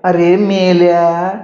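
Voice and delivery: fond mock scold, stretched out; affectionate scolding on request. A narrator's reading. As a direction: say "अरे मेल्या" slowly, scolding but loving. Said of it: "आई पुन्हा म्हणा ना".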